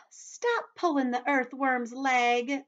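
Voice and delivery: high, loud, slow scolding; scolding ladybug. Acted. Ladybug scolding the centipede, high and drawn-out. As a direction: tell off loudly, stretching it high.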